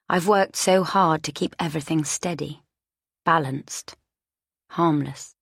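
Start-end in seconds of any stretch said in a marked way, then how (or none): none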